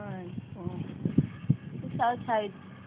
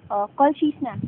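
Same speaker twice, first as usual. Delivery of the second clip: normal talking voice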